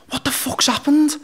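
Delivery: high, slow, bewildered question; just woken and lost; startled confusion. Retold or imitated. Groggy son woken by screaming, bewildered high cry.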